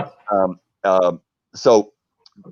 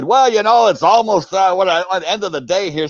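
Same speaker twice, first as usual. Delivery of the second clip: guard quoted; 8 semitones higher, 4 dB louder than usual. Retold or imitated. Talisker guard speaks to visitor.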